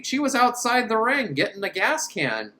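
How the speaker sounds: ordinary talk, nothing marked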